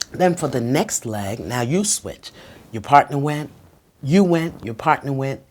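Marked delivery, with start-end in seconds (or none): none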